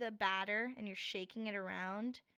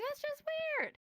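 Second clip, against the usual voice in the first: teasing; 3 dB louder than usual. Real, not acted. Teasing aside.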